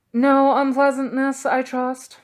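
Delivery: put-on a tutor gently checking the boys; dry Magus. A narrator's reading. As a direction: raise an eyebrow with the words. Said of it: "said the Magus".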